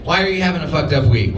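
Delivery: ordinary delivery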